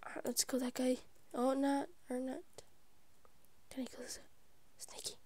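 very soft, hushed sneaking voice; stealthy whisper. Real, not acted. Player sneaking up to kill someone, hushed and stealthy. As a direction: whisper it as you creep up on a target.

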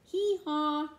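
loud braying hee-haw like a donkey. Acted. The donkey in the song braying, loud. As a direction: bray it out, loud and high.

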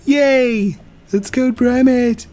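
excited friend voice. Retold or imitated. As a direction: voice the friend's giddy yay, high up. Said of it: "He's like".

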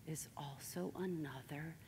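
slow, near-whispered revelation; very soft, low, solemn disclosure; grave hush. Real, not acted. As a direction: let the voice sink almost to nothing. Painful reveal about friends' killer.

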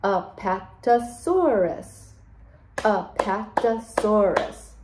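Apatosaurus drawn out slowly in five beats; Apatosaurus, slow and split into beats; syllable by syllable. Shown as a demonstration. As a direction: separate each syllable of Apatosaurus for counting. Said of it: "Do it with Miss Holly".

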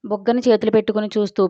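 usual speaking voice

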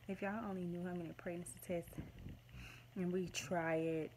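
normal talking voice

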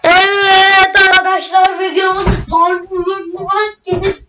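slow, drawn-out host sign-off; outro voice. Acted. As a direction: wrap up the video for viewers. Encerrando o vídeo para o público.

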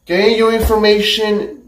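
ordinary talk

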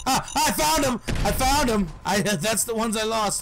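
high, loud, frantic exclamation; alarmed excitement. Real, not acted. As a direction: yell the sudden discovery, half laughing. Finds the lost enemies suddenly.

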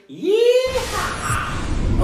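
very high cowboy whoop; yeehaw. Acted. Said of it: "Give yourself a yeehaw".

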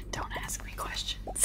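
giggly whispered reply; whispering. Real, not acted. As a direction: keep whispering through a laugh. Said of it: "Why are you doing A S M R now?".

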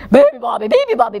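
loud bellowed chant; bellowing. Acted. Bears joining to wake the bird, bellowing. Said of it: "bellowed the bears".

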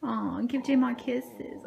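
ordinary talk, nothing marked

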